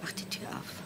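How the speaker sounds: a hushed, pitiful whisper; whispered plea. Retold or imitated. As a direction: imitate her own future begging softly. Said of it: "und sag".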